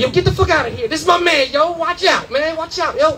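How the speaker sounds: put-on high, excited street kid calling out; street kid hustlers. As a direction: hype up like a kid hawking weed at the car window. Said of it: "They're like".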